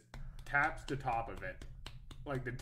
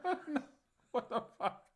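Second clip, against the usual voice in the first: shocked disbelief; 12 semitones higher than usual. Retold or imitated. His shocked reaction retold.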